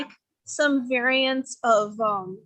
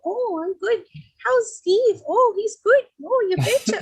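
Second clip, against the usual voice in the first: much higher, chirpy mock Minnesota accent; imitating Minnesota ladies; 10 semitones higher, 3 dB louder than usual. Retelling ladies chatting in Minneapolis, in a high mock Minnesota accent. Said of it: "I heard the accent from Fargo".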